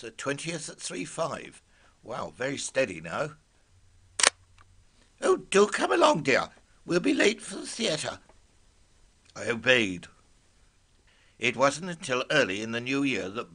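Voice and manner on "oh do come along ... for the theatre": high, loud lady's voice. Acted. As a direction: call out high like a fussy lady. Wife voiced in the story.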